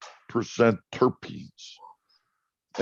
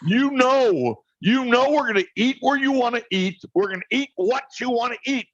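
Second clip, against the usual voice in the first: mock-exasperated husband, much higher; exasperated outburst; 11 semitones higher than usual. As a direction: throw up your hands, done with the dithering. Jim's exasperated outburst at wife.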